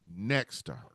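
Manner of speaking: ordinary talk, nothing marked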